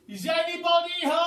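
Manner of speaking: loud high call up to the windows. A narrator's reading. Boy calling up to the windows, loud hopeful call. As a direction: call up to an empty house. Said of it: "stood right under the windows and called out".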